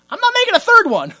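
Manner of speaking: high, loud comic yell; hammed-up fed-up refusal; outraged refusal. Puppet maker refuses.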